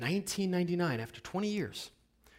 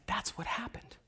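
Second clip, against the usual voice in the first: knowing whisper with a lift; whispered reply; 3 semitones higher, 9 dB quieter than usual. Retold or imitated. Conductor answering people, whispering. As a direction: whisper it like a secret.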